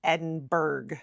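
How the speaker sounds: "Edinburgh" ending in -berg with a hard g; the American mistake, "Edinburgh" rhymed with iceberg; mispronounced with hard G. Shown as a demonstration. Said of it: "not to end with the letter G".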